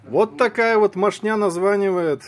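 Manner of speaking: loud and fed up, high; exasperated shout. Real, not acted. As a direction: blurt it out in disgust. Annoyed aside after the call.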